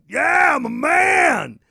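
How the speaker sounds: slow, high, loud macho bellow; macho man shout. Retold or imitated. Podcast host mocking a show's macho persona with a slow loud shout. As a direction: belt it out like a meathead TV host. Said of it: "Jimmy Kimmel was part of The Man Show".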